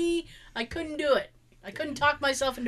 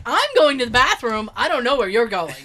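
exasperated, louder; 8 dB louder than usual. Retold or imitated. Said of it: "And I'm like".

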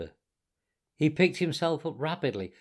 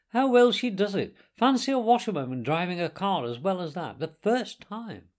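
high-pitched, impressed exclamation; admiring gentlemen; 5 semitones higher, 3 dB louder than usual. Acted. Gentlemen applauding the washerwoman's driving, admiringly amazed. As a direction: marvel aloud, slowing on the last words.

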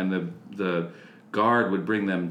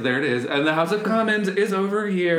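flustered tour guide; 9 semitones higher, 4 dB louder than usual. Retold or imitated. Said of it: "it's like".

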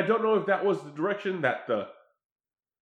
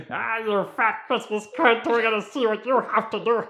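phone voice app; 7 semitones higher than usual. Retold or imitated. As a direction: stretch it out like a robotic voice app. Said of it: "It's totally like that".

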